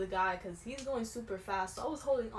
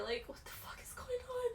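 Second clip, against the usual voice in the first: panicked whisper under the breath; hushed freaked-out thought; inner whisper; 5 dB quieter than usual. Retold or imitated. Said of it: "in the back of my head".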